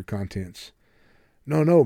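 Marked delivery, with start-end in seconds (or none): 1.5-1.8 higher, louder urgent cry of no. A narrator's reading. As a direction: cut him off urgently, a bit louder. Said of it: "Mick cried urgently".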